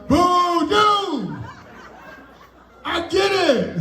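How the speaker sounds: high taunting boo down the phone; mock heckler on phone.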